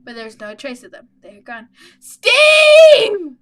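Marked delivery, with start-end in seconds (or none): 2.0-3.4 very loud, high desperate calls; screaming names. Acted. Lost in the forest, screaming for missing friends, desperate loud calls. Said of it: "I scream their names in the dark".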